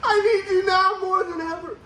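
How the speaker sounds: high, hammed-up sobbing plea; hammed-up whiny cry, breaking into a laugh; mock crying plea. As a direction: beg her to stay, faking the tears. Begging fiance to stay, retold.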